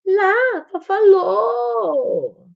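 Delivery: put-on high, loud child's call with a very long vowel; high drawn-out baby talk; excited toddler pointing out. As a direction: call it out like a toddler insisting on being understood. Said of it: "E aí ela fala pra você assim".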